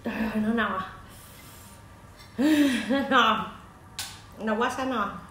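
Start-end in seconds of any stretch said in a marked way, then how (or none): none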